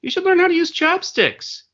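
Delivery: high, bright retold advice; quoting himself. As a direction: coax mom to learn chopsticks. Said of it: "So I said to my mom".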